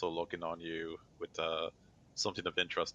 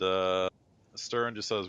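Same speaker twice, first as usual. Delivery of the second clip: ordinary delivery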